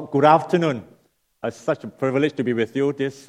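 usual speaking voice

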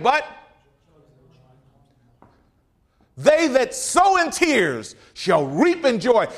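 loud, ringing pulpit cry; booming revival preaching; full-force preaching. Acted. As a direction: proclaim it to the back pews.

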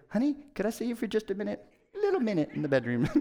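lightly voiced wife asking discreetly; imitated tactful wife. Wife discreetly asking him aside.

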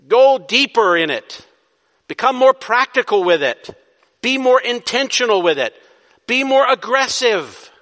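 building pulpit exhortation, each line pushed harder; preaching at full force. Acted. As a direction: drive each command home to the congregation. Preaching climax to congregation.

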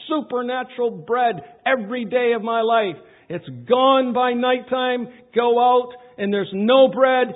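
loud, whiny grumbling, stretched out; complaining Israelites. Retold or imitated. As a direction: moan it like a spoiled crowd craving meat. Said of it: "We wept again and said".

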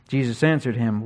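ordinary delivery

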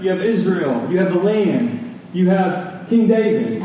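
usual speaking voice

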